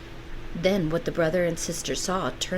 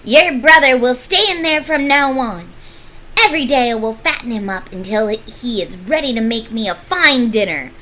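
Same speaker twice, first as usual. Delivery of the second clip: loud, high witch roar; 6 semitones higher, 10 dB louder than usual. Acted. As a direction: roar the threat at her. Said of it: "The witch roared to Gretel".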